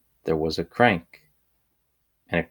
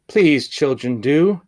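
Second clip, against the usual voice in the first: higher, louder anxious plea; a governess begging the girls to say what's wrong; worried governess; 8 semitones higher, 6 dB louder than usual. A narrator's reading. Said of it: "And she said".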